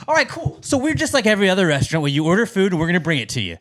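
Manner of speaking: imitating an overfriendly waiter; restaurant server spiel. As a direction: recite the welcome like an eager server. Continues imitating server's scripted welcome.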